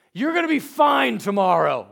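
high, loud, imitated pep talk; forceful encouragement. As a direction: declare it like a suddenly tough mom. Wife switches to firm encouragement.

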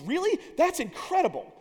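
high, sarcastic mock amazement; sarcastic brush-off. Jackie Robinson dismissing the scout, high sarcastic disbelief. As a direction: fake wonder, brushing him off. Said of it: "totally blows the guy off".

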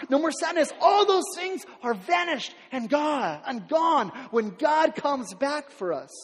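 high, loud, slowing sermon climb; full-force preaching. Acted. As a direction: preach it out to the back row.

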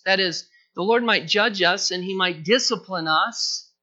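ordinary talk, nothing marked

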